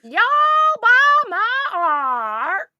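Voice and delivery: hammed-up slow Southern drawl. Shown as a demonstration. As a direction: stretch "Y'all" and every vowel in a Southern drawl. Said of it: "I'm dragging out the word, saying it very slowly".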